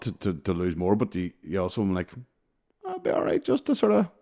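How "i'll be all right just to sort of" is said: very high, put-on carefree voice; mock self-permission. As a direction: talk yourself into it in a high, loud squeak. Voices temptation to regain the weight.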